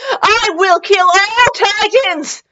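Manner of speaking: high, drawn-out mock battle cry; over-the-top heroic vow. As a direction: declare it like a slow, high-pitched war cry.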